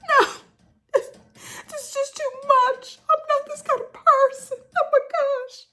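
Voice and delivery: very high, hammed-up tearful squeal; mock crying. Mimicking a contestant crying over her food, overacted weepy voice. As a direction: imitate someone breaking down in fake sobs. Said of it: "girl, why are you crying".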